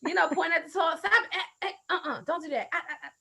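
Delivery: usual speaking voice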